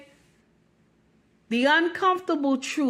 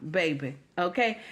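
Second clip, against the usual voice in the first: ordinary talk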